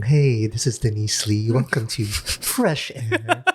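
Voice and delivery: soft, slow radio host voice; slow public radio announcer intro; NPR host voice. Retold or imitated. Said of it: "Very soft and accepting".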